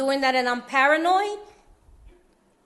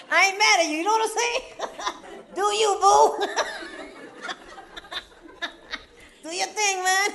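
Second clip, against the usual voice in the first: high laughing good-natured voice; 8 semitones higher than usual. Real, not acted. Speaker wishing his carefree cousins well, laughing. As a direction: laugh it off kindly.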